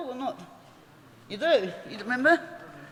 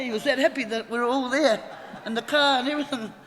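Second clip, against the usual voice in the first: normal talking voice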